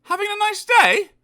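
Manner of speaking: very high, cheery tease; teasing. Acted. Mister Happy teasing Mister Grumpy, high and cheery. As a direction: needle him politely. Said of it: "grinned Mister Happy".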